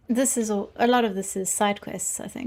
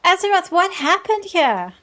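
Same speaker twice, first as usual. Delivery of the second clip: astonished; 8 semitones higher, 5 dB louder than usual. Acted. Reading a line in the vision.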